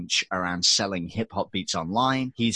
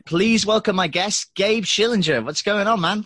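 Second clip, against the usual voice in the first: big announcer intro; 11 semitones higher, 5 dB louder than usual. Acted. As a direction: sell the guest's name like a showman. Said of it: "let me re-record that bit".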